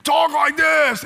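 loud, high, drawn-out strained voice; slow, forced leper impersonation. Acted. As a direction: stretch it out like an afflicted leper. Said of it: "So they would".

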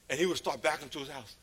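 ordinary talk

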